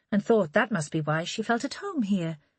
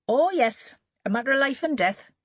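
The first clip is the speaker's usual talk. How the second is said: light and flippant; 7 semitones higher, 3 dB louder than usual. A narrator's reading. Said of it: "Her voice light and flippant".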